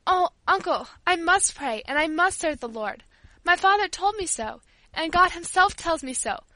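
earnest, insistent appeal; impassioned pleading. A narrator's reading. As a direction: plead fervently with an angry uncle. Paula pleads to be allowed prayer.

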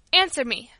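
loud stern order; forceful parental command; demanding. A narrator's reading. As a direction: demand it firmly, as a father. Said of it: "demanded my father".

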